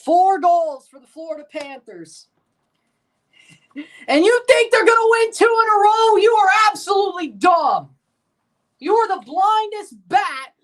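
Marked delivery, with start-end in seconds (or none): 4.1-10.6 loud, higher furious taunt; angry shout. Real, not acted. Fan yelling at people who doubt him, loudly. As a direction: yell it at the fool.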